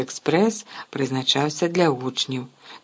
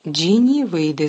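bossy order; 3 semitones higher, 7 dB louder, slower than usual. Acted. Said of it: "звелів Рон".

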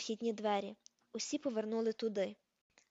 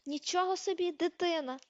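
higher and brighter, amazed outburst; exclamation; 6 semitones higher, 3 dB louder than usual. A narrator's reading. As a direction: blurt it out as if never heard of babies. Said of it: "А Рон вигукнув".

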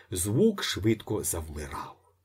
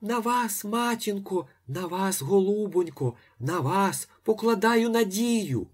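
much higher, quick little pleading patter; pattering priest; 11 semitones higher than usual. A narrator's reading. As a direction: priest pattering entreaties to the lady. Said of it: "задріботів".

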